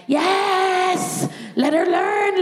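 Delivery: high, frantic agreement; high strained cry of yes; desperate yes. Retold or imitated. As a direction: yell it out in pained desperation. In agony, urging the student nurse.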